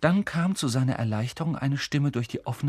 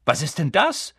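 higher, louder surprised cry; sudden exclamation; 6 semitones higher, 5 dB louder than usual. A narrator's reading. Twin suddenly pointing at Harry's scar, surprised cry. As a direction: blurt it out, startled. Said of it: "rief einer der Zwillinge plötzlich".

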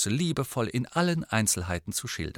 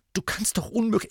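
high-pitched scandalised protest; shocked; 10 semitones higher, faster than usual. A narrator's reading. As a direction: protest in disbelief, cut off midway. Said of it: "sagte Hermine und klang schockiert".

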